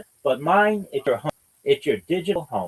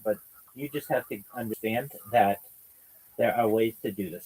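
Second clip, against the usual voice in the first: normal talking voice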